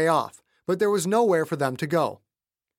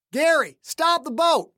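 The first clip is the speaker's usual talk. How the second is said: shouted order; 12 semitones higher, 4 dB louder than usual. A narrator's reading. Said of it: "He shouted up to the flying bridge".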